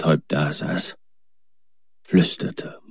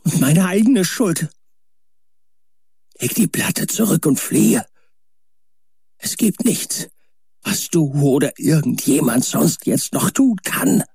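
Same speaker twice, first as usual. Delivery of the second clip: higher, distant voice of a trapped man; Warren's desperate voice; 9 semitones higher than usual. Acted. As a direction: plead with him to flee, sounding far away. Narrator voices Warren on phone.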